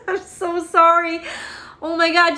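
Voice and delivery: emotional, laughing and tearful; teary apology. Real, not acted. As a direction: apologize while welling up. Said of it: "that scene always gets me".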